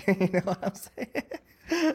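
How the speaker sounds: slow, high, breaking up with laughter; laughing. Real, not acted. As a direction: laugh your way through the words. Laughing over own point.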